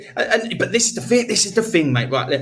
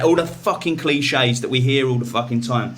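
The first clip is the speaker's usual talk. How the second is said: ordinary delivery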